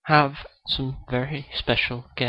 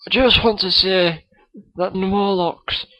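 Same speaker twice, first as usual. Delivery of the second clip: a kid's hammed-up Scottish accent; Billy Connolly impression; 3 semitones higher than usual. Guest Billy Connolly greets the podcast host, mock Scottish accent. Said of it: "Billy Connolly is on the show".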